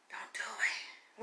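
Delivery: hushed warning. Retold or imitated. Warning playful cousins, slow hushed threat. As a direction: whisper the threat slowly.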